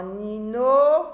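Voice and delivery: "nino" slow, with a loud, long rising final syllable; emphasized syllable. Shown as a demonstration. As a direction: lean on the "no" in "nino". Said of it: "No qui se répète".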